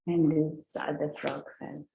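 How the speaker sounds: ordinary talk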